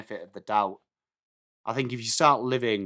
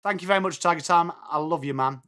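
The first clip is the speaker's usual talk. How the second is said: ordinary talk, nothing marked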